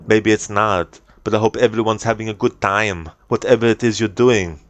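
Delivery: conversational Austrian accent, plainly spoken without filler noises; everyday streamer chat in an Austrian accent; natural Arnie accent. Shown as a demonstration. Greeting stream viewers normally in an Arnie accent. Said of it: "talking just normally with his accent".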